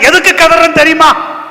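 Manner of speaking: high, loud challenge; shouted demand. Acted. Captain confronting Jonah about the crying, shouted demand. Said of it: "captain வந்து கேக்குறான்".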